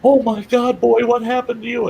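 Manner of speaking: high, loud alarmed outburst; panicked cop. Retold or imitated. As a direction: imitate a cop panicking at the sight of you. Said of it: "he's in a panic".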